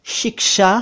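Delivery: both sh sounds clear in शिक्षा; correct pronunciation. Shown as a demonstration. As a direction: model शिक्षा with k-sh. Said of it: "शिक्षा नहीं".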